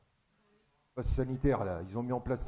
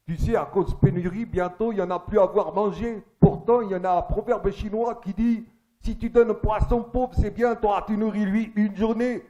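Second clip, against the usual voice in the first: put-on raised, loud caricature Chinese accent; 7 semitones higher, 5 dB louder than usual. Chinese coach reciting a proverb, mock accent. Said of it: "mon chinois, il a grandi au Japon".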